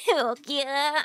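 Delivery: ordinary talk, nothing marked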